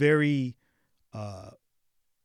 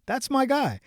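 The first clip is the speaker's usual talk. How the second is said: pitched up, thrilled; a higher, excited repeat; 10 semitones higher than usual. Retold or imitated. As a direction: burst out in joy. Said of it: "And I'm like".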